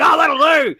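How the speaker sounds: lazy, dismissive mock voice; careless mock attitude. Mocking careless attitude of Australia.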